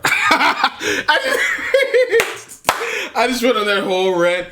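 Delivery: words squeezed out through real laughter; laughing at himself. Real, not acted. Host laughing at his own misheard rant, laughing. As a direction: laugh sheepishly at the long rant you just went on.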